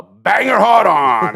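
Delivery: loud, drawn-out comic holler; shouted punchline. Pretend. Telling the guys what the medicine is for, shouted punchline.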